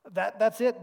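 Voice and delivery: That, that's it cut in high and loud; Betsy cutting in on her sister, excited; eager interruption. A narrator's reading. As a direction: jump in, excited. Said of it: "Betsy interrupted".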